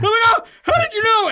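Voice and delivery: high, loud mock wail; hammed-up wail. Caught by his wife, a hammed-up high wail.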